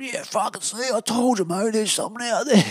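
very high, squeaky indignant mimicry; hammed-up high-pitched I-told-you-so; mock panicky mate. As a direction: imitate a vindicated, rattled mate.

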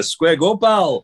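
imitating a Glasgow pub challenge; glasgow street accent. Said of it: "traditional Russian phrase there".

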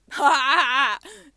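big, loud burst of laughter; loud guffaw. Real, not acted. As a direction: laugh hard and loud at the absurd idea. Scoffs at her own cheap price.

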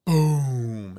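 imitated explosion, one long falling boom; loud drawn-out "boom" sinking in pitch; firework boom. Recounting a bomb-like firework, imitated boom.

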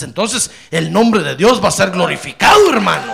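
loud, rising preacher's call; preaching shout. Real, not acted. Preacher promising God's glory to his flock, a loud call. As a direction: proclaim it to the whole congregation.

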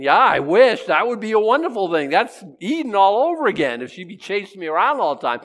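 loud, high hopeful voice; a hopeful husband, loudly; men's wishful thought. Retold or imitated. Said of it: "all the men are thinking".

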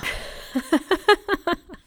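eerie cackle. Acted. Witch's friend agrees to spell.

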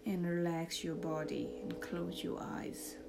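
soft, slow meditation voice; calm, hushed, lead them into relaxation; guided meditation. Acted.

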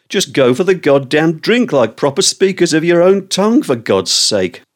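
an exasperated, high-pitched grumble; exasperated rant. Real, not acted. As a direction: pitch rising high in mock outrage. Ranting about bad grammar.

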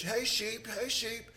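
put-on a light New Zealand accent, calling high to the sheep; New Zealand shepherd call. Shepherd calling his flock, lightly New Zealand accented, pitched high. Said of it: "in a New Zealand accent".

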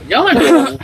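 talking through high laughter; laughing. Real, not acted. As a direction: laugh while pleading, voice squeaking up. Laughing while pleading not delete.

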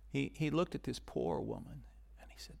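soft unvoiced storytelling; quiet, reverent whisper; hushed whisper. A narrator's reading. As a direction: draw them in with a whisper. Hushed retelling of tender moment.

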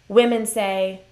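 ordinary delivery

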